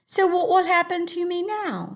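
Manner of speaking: young Sophie in a put-on English accent. Teacher reading to her class as Sophie, English accent. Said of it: "an English accent".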